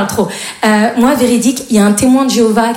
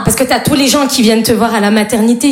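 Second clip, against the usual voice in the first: normal talking voice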